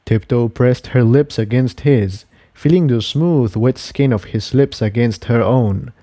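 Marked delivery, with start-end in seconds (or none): none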